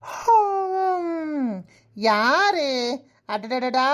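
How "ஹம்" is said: sleepy groan. Acted.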